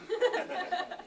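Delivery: very high, mock machine-gun cackle; imitated cackle. Lecturer mimicking Rothbard after his punchline, a very high mock cackle. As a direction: toss off a squeaky high cackle like the man himself. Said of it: "And then he cackled".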